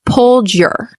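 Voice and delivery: "Pulled your" joined as one, stress on "pulled"; linked words. Shown as a demonstration. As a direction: run "Pulled your" together, "your" almost swallowed. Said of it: "You can connect those".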